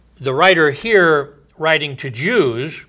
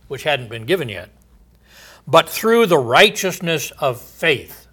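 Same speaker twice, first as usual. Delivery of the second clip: raised, ringing pulpit voice; solemn scripture reading; 3 semitones higher, 4 dB louder than usual. Acted. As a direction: declaim God's oath from the pulpit. Reading Romans verse thirteen.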